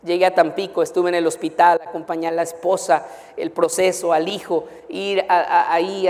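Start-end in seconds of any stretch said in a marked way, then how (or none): none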